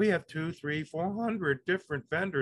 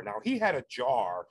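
ordinary talk

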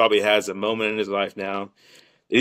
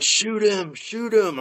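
much higher, urgent retold shout; urgent yell; 12 semitones higher, much slower than usual. Yelling at his buddy to shoot the duck, high and urgent. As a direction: urge him on frantically, pitched high. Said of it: "I'm yelling at him".

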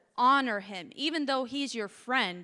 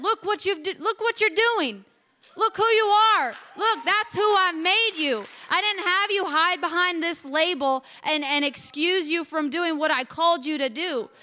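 raised, louder voice of the Lord, half laughing; an exultant, lifted divine voice; voicing the Lord; 5 semitones higher, 6 dB louder than usual. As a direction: proclaim it like God speaking over her. God's voice quoted in testimony.

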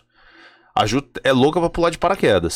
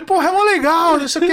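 cheerful coaxing; 12 semitones higher than usual. Retold or imitated.

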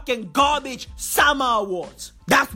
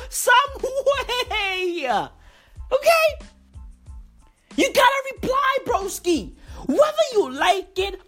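pitched-up yelling; high excited shout; 11 semitones higher, slower than usual. Real, not acted. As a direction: yell it out high. Shouting at [name], laughing.

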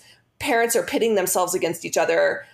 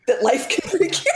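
words breaking into a higher-pitched laugh; 9 semitones higher than usual. Real, not acted. Mom ending her sad little tale, laughing. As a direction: laugh through the end of the line.

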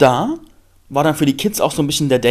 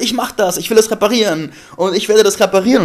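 eager; 6 semitones higher, 5 dB louder than usual. Retold or imitated. Eager self-quote.

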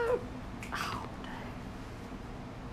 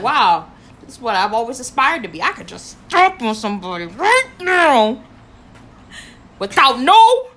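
excited; 9 dB louder than usual. Retold or imitated. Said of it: "I was like".

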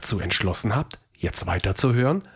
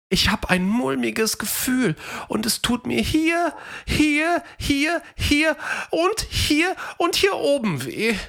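poorly Theo; 13 semitones higher than usual. A narrator's reading. Sick Theo tells Fred where it hurts, whiny. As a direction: moan about every aching spot.

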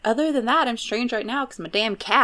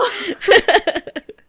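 high, loud peal of laughter; 12 semitones higher, 6 dB louder than usual. Real, not acted. Host laughing along with her cohost, high laugh.

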